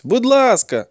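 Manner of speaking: put-on high-pitched boy begging; pleading. A narrator's reading. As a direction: beg in a high voice. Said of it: "заблагав хлопець".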